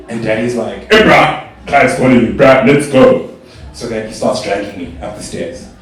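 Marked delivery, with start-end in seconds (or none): 0.9-3.3 loud barking seal voice; comic loud seal-man bark; seal-like Daddy. Retold or imitated. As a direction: imitate a seal calling out. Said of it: "he sounded like a seal".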